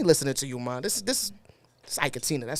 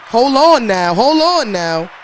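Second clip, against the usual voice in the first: loud hype exclaim; 3 semitones higher, 8 dB louder, slower than usual. Real, not acted. Hype interjection while reading.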